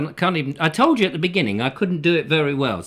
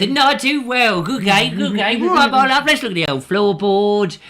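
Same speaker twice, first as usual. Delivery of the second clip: higher, louder, exuberant catchphrase delivery; game-show host; 6 semitones higher, 7 dB louder than usual. Acted. Game-show host as a funny James Bond, showy and higher-pitched.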